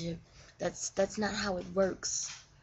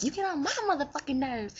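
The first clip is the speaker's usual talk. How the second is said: higher, sassy comeback with a punch on motherfucking; exasperated partner; 7 semitones higher than usual. Retold or imitated. His partner firing back, mock-exasperated and higher. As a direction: snap back even more annoyed, playfully. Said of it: "And she like".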